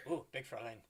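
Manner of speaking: put-on half-swallowed German-accented aside. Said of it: "German accent is not very good".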